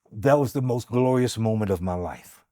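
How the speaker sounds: ordinary delivery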